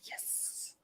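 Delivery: breathy whispered yes. Retold or imitated. Ravens fan whispering yes to the move. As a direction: whisper it like a secret thrill. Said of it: "your reaction should be".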